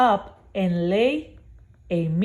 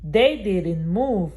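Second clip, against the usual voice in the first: plain reading voice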